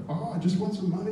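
high pleading voice; a meek high plea for money; startled beggar. Retold or imitated. Beggar pinned to the wall, a high meek plea. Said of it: "they were like".

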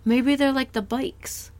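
dawning idea, slowed down; excited realization. Real, not acted. Sudden idea after gasp.

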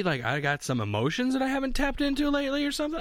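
ordinary delivery

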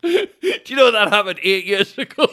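can't stop laughing; laughing hard. Real, not acted. Laughing at himself over an eight-year-old grudge.

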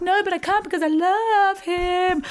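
high, slow, pleading lovesick voice; hammed-up lovesick refusal; mock lovesick whine. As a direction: plead like a besotted teenager.

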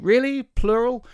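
high, loud mock-astonished question; sarcastic disbelief. Comedian mocking the one-eyed wife crying, with loud high disbelief. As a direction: pitch it up high in fake amazement. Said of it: "I just stopped myself saying".

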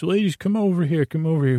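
high, quick busker call; a busker pulling in a crowd, high and quick; clown calling out. Retold or imitated. Clown calls crowd over.

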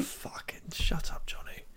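very soft, slow whisper; whispered retort. Real, not acted. Tall man telling Johnny to stop teasing, whispered. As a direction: hiss it under your breath. Teased about height, hushed retort.

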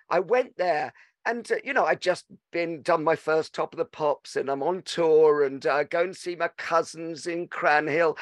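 normal talking voice

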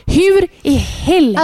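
slow, high incredulous outburst; exasperated disbelief. Retold or imitated. Mother told to breathe through pushing, slow incredulous cry. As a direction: drag it out in disbelief. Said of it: "och man bara".

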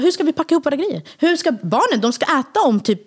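high-pitched, indignant imitation of husband; retold anger. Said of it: "Nej, han var så arg". (återgiven upprörd, ilsken ton)

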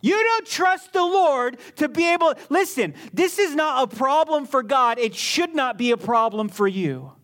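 firm, emphatic reproof. Retold or imitated. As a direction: re-enact laying down the law to a patient. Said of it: "in a firm tone".

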